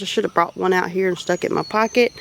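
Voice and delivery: usual speaking voice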